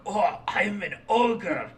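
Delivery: put-on slow Scottish-accented ogre; mock Scots, words drawn out; Shrek impression. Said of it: "line from Shrek where it's like".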